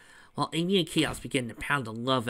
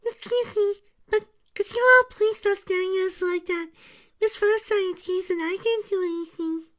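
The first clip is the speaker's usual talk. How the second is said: put-on much higher, small polite child's voice; timid little girl; 5 dB louder than usual. Cream asking the changelings to stop staring, timid and high. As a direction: plead politely like a shy little rabbit. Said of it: "Cream murmured".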